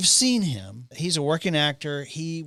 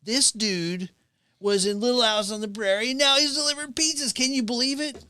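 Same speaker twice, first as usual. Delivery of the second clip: giddy fan; 10 semitones higher than usual. Retold or imitated. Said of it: "She's like".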